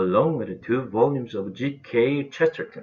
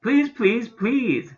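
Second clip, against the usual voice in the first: high, louder begging; desperate pleading cry; 12 semitones higher, 3 dB louder, much slower than usual. A narrator's reading. Toby begging Mom and Dad for the name, high desperate pleading. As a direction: plead harder with each please. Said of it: "cried Toby".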